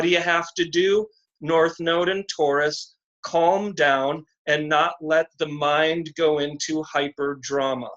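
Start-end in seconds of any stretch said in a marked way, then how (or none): none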